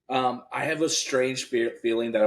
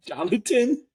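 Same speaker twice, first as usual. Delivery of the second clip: much higher, laughing disbelief; incredulous laugh; 13 semitones higher, 3 dB louder, slower than usual. Real, not acted. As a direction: repeat the name in amused disbelief. Incredulous repeat of odd name.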